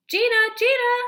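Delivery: put-on the mother shouting to wake her child; worried mother's cry; mother calling out. A narrator's reading. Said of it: "It's my mother's voice".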